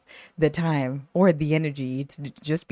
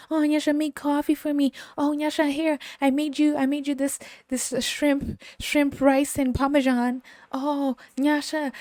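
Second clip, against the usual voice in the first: mocking whiny coworker; 9 semitones higher than usual. Mocking a needy coworker to his face, high and whiny. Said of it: "you come in and be like".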